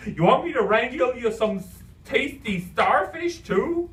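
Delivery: high, loud goofy offer; squeaked. A narrator's reading. Imaginary friend offers the boy a snack, squeaky cartoon voice. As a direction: squeak it, drawing out the last words. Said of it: "he squeaked".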